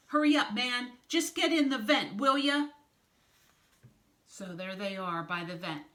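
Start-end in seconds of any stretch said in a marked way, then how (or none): 0.1-2.7 loud, higher-pitched impatient command; impatient urging. A narrator's reading. Snake pushes the team into the vent, impatient. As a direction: hurry them along loudly.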